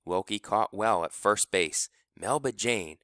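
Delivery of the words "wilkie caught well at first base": ordinary delivery, nothing marked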